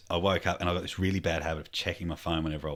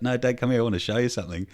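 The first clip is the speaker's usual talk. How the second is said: higher, lightly voiced small boy; insistent child; 6 semitones higher, 4 dB louder, faster than usual. Son wants to show dad something, lightly imitated insisting child.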